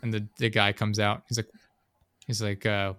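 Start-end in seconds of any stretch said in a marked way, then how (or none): none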